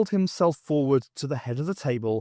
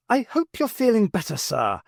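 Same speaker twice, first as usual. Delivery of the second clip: a higher, innocent-sounding voice to fool him; voice pitched up; 9 semitones higher than usual. Acted. The doctor pitching his voice up to fool Tragan. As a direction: pitch the voice much higher, putting on a mild act. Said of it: "pitching his voice up".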